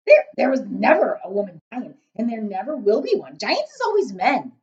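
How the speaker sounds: big loud giant, muddling his words. A narrator's reading. Giant grumbling to Sophie about snozcumbers, big booming voice. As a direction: boom it out as a kindly giant.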